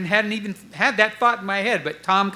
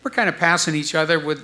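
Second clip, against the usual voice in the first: normal talking voice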